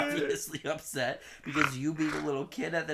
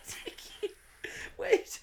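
mock pleading mom; much slower than usual. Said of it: "she's just like".

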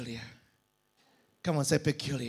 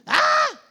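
high sung "Ah!" belted out loud; a sudden high singing note; sung high cry; 10 dB louder than usual. Shown as a demonstration. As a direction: belt a high sustained note. Demonstrating singing frequency.

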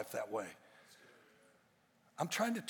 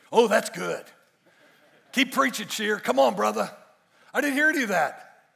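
mock congregation cheer; 6 semitones higher, 11 dB louder than usual. Mimics the response he wanted.

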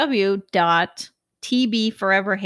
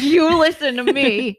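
loud, high, playful demand; mock threat; 6 semitones higher, 5 dB louder than usual. Friend playing angry customer at the window, mock threat. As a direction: pretend to grab someone and order them about. Acting out grabbing the barista.

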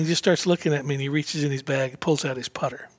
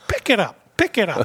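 Jack conceding the putt; 6 semitones higher than usual. Retold or imitated. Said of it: "He says".

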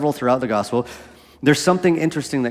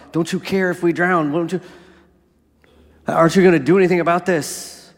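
higher, desperate pleading; panicked plea; 5 semitones higher than usual. Acted. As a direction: beg for rescue in a panic. Panicked disciples plead with Jesus.

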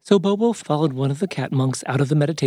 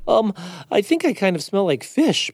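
high, hesitant storybook character; higher voice, a touch awkward; Bobo the bear; 7 semitones higher than usual. Acted.

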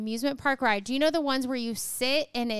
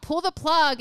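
raised-pitch mock yell; retold yell; 5 semitones higher, 3 dB louder than usual. Rider yelling for help from a stuck ride, mock yell. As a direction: mildly imitate a rider calling out. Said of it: "someone yells out".

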